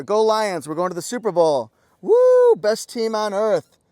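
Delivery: rally the fans, full-throated; fan cheer. Real, not acted. Reviewer cheering on the Detroit Lions, loud fan cheer. Cheering for his football team.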